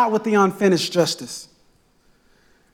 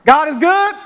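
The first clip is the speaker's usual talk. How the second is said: calling out God is good, raised and loud; call to the congregation; 7 semitones higher, 4 dB louder than usual. Shown as a demonstration. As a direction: call it out to the room to get a reply. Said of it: "All right, let's try".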